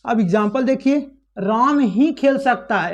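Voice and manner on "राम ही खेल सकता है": ही leaned on hard and drawn out; emphatic, stretched ही; stress on ही. Shown as a demonstration. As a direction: press down on the word ही, slowly. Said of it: "यहाँ पर ही पर क्या दिया जा रहा है? दबाव दिया जा रहा है".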